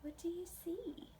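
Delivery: soft whispered question. Shown as a demonstration. Teacher whispering to the children about the picture. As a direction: breathe the question to the kids.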